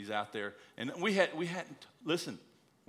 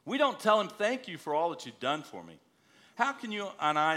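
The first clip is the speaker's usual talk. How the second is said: preaching; 4 semitones higher, 6 dB louder than usual. Acted. Exhorting about thanking God.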